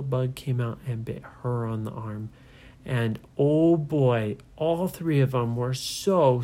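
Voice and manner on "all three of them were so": usual speaking voice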